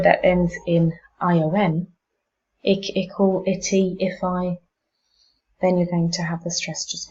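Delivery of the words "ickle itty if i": ordinary talk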